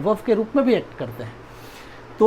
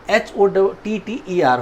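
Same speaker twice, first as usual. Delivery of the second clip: slow, even letters, H O T T E R one at a time; dictating spelling; much slower than usual. Shown as a demonstration. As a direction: spell each letter of H O T T E R for the class. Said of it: "teacher spells out hotter".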